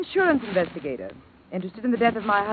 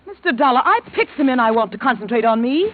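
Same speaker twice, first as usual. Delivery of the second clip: louder, sharp indignant retort; indignant snap; 5 semitones higher, 9 dB louder than usual. Acted. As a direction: put him in his place, sharply. Widow snaps at investigator.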